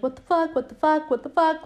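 lightly acted freak-out. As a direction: imitate a man freaking out, repeating in a rising pitch. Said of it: "freaking out in this moment".

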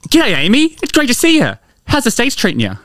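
high-pitched, hammed-up Aussie greeting; Australian accent. Greeting a friend back from the States, high Australian accent. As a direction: gush hello in a broad Australian accent.

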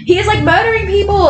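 high, outraged exclamation; incredulous. Real, not acted. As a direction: exclaim it in disbelief. Outraged at Bella.

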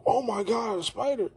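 high-pitched matchmaker panic; mock alarm. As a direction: squeal it as a startled character. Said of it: "then the matchmaker is like".

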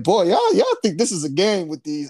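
podniesiony, głośny głos Boga, żartobliwie; mock voice of God. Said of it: "God said".

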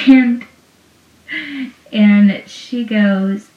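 ordinary talk

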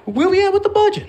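high, fast, puffed-up manager question; mock boss voice. Pranking Marie with a smug boss question, high and fast. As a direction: ask it like a smug executive. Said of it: "And I go".

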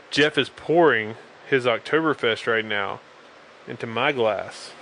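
ordinary talk, nothing marked

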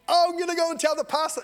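high, fast mocking voice; mock churchgoer.

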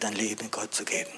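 ordinary talk

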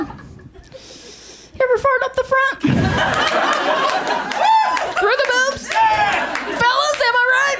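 high, laughing fellas bit; mock bro crowd work. Comic asking the men in the crowd, high hammed-up bro voice. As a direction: ham it up like a hack comic.

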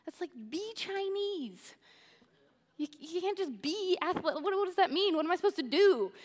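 high, baffled mock dad voice; a lightly voiced confused father; imitating puzzled dad. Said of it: "he would say".

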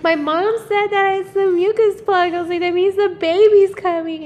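high, loud and eager; telling the boyfriend the baby is coming, high and excited. Retold or imitated.

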